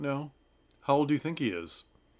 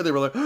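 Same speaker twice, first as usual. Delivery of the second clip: gasp; 9 semitones higher, 5 dB louder, much faster than usual. Retold or imitated. Audience gasping at underwear scene.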